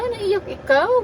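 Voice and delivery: high, loud cooing question. Real, not acted. Mother asking baby about crying, high baby talk.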